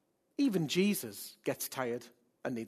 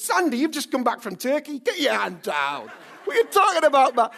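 loud, high, joking telling-off; mock scolding; 9 semitones higher, 9 dB louder than usual. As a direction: playfully shout down a congregant. Teases congregant with hand up.

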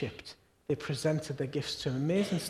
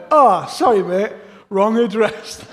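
much louder, higher sheepish driver with a laugh; apologetic driver; 4 semitones higher, 12 dB louder than usual. Retold or imitated. Driver apologises for the wrong address, loud and sheepish. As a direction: toss off the apology cheerily. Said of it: "He went".

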